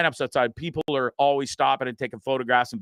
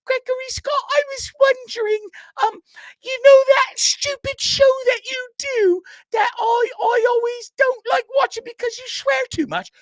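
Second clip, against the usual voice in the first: much higher, loud mock-mom voice; mom impression; 5 dB louder than usual. Son imitating mom asking him to post, much higher and louder. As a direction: ham up his mother nagging him. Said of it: "I do her voice like that".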